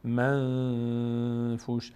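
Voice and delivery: منفوش with the ن held as a long nasal hum; nasal held noon. Shown as a demonstration. Teacher demonstrating the hidden noon, ن held long in the nose. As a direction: slowly hum through the ن of منفوش before the ف.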